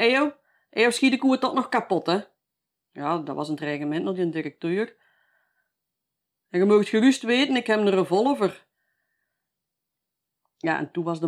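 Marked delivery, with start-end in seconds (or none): 0.0-2.2 much higher and louder retold yelling; drunken threat. Drunk old man threatening the director, high loud yelling. 6.5-8.7 louder and higher, retold menacing shout; drunken threat. Drunk old man warning the director he has a gun, loud shout.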